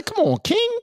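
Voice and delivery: very high put-on voice; a lightly voiced coached witness; mock coached line. Shown as a demonstration. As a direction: say it the way he should. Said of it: "You gotta say".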